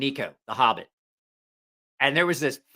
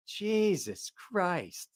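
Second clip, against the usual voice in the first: very slow, softer, raised pitch; drawn-out exasperation; 4 semitones higher, 7 dB quieter, much slower than usual. Retold or imitated. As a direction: stretch each word out in retold disbelief. Retold weary reaction to Mike.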